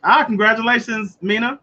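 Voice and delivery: normal talking voice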